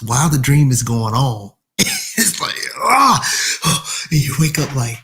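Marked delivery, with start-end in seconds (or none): 2.8-3.2 louder, higher jolt awake yell; mock startled yell. Dreamer jolting awake, mock yell. 3.3-4.1 sudden unvoiced gasp of waking; sharp gasp. Dreamer snapping out of sleep, sharp gasp.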